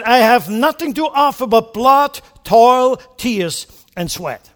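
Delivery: loud, high wartime oration; a grave, booming rally cry; Churchill quote. Retold or imitated. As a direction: declaim it like Churchill to Parliament. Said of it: "He said".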